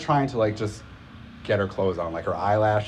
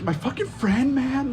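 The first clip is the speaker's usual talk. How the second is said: very high-pitched tearful whine; mock drunken crying. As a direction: imitate a drunk friend wailing. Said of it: "starts crying".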